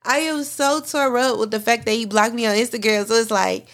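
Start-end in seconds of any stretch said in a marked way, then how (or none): 0.0-1.2 real tearful voice, higher, laughing through tears; crying. Real, not acted. Confessing to listeners about being blocked, crying through laughter. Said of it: "I'm really crying right now".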